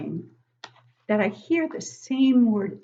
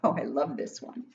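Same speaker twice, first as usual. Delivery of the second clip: ordinary delivery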